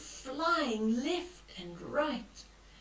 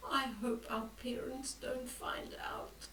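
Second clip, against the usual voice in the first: much softer, whispered fret; worried whisper; 9 dB quieter than usual. Acted. Boy whispering his fear about parents finding out. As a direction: worry under your breath.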